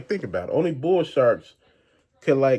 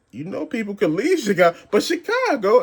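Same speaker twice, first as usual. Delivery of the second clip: exasperated retort; 7 semitones higher, 5 dB louder than usual. Retold or imitated. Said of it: "I'm like".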